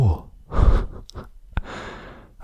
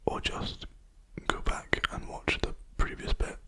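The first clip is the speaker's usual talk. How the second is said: unvoiced ASMR whisper. Real, not acted. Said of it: "I keep whispering, sorry, force of habit".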